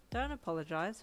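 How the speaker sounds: ordinary delivery, nothing marked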